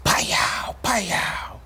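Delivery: breathy voiceless pow pow like gunfire; imitated gunshots. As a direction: spit out two gunshot sounds. Imitating gunfire in retold story.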